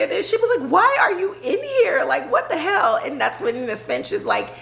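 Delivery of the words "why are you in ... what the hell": mock yell. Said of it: "she's screaming at the principal".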